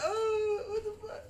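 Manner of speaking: very high hammed-up wail; imitated high-pitched crying; mock crying. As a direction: wail it like a hurt kid, for comedy. Acts out himself hurt and crying.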